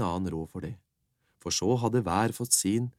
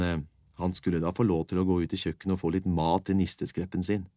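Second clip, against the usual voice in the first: ordinary delivery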